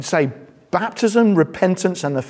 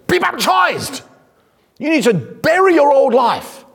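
higher, forceful command as John the Baptist; blunt commanding prophet; 9 semitones higher than usual. Retold or imitated. Preacher acting out John commanding the crowd, forceful and blunt. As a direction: bark it like an un-British prophet. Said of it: "John the Baptist says".

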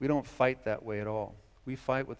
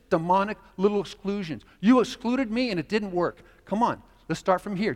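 higher, louder voice of Jesus; Jesus calling the disciples onward, louder; voicing Jesus; 6 semitones higher, 8 dB louder than usual. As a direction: urge them on as Jesus. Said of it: "Jesus says".

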